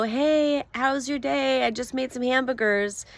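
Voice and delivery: a lightly voiced sunny partner calling out; cheerful greeting.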